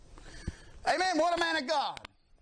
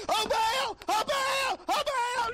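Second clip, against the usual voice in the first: high, hammed-up frantic wail; mock the worshippers calling on their god; exaggerated high-pitched pleading cry; 13 semitones higher, slower than usual. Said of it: "And they're crying out".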